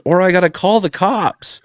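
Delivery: high warning of the cops; mock threat. Banker threatening the bum with the cops, high warning. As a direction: threaten him with the police.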